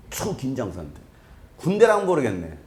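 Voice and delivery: ordinary delivery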